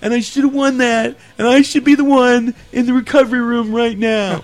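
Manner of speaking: lightly voiced sulking girl; mock whiny contestant. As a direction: whine about being cheated. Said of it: "this chick's just like".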